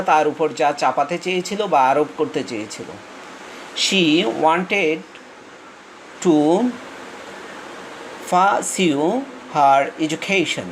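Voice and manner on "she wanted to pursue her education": each word drawn out slowly for the class; slow reading aloud. Shown as a demonstration. Teacher reads the sentence slowly to the class, very slow. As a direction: dictate it slowly to students. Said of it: "এটার উচ্চারণটা খেয়াল করো".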